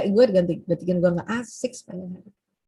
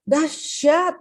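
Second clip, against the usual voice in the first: raised, excited dahsyat; higher energy; 6 semitones higher than usual. Shown as a demonstration. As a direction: say it with big enthusiasm. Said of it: "Atau naikin lagi". (penuh semangat)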